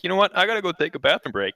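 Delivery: lightly hammed-up casual exit line; imitating departing player. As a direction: briskly mimic someone excusing himself. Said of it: "and he's like".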